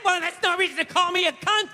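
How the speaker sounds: lightly voiced whiny girlfriend; a hint of a huffy woman; mock offended woman. Comic mocking an offended girlfriend, lightly voiced.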